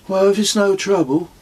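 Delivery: put-on deadpan kid going along with the gag; boy's voice. Son plays along with joke.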